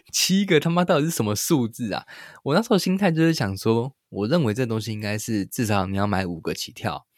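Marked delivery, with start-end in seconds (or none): 0.1-1.7 high laugh breaking through the word 七个. Real, not acted. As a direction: laugh at how absurd that was. Laughing at own odd number choice. (笑着说七个)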